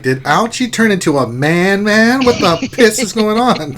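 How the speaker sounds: loud, high, mock bewilderment; indignant, incredulous outburst; Urs. Host imitating Urs hearing the changed voice, baffled. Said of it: "Urs is like".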